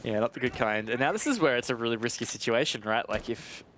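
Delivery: ordinary delivery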